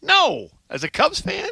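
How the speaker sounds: high-pitched mock disbelief; mock outrage. Real, not acted. Cubs fan scolding the co-host, high mock outrage. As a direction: act scandalized, voice jumping up.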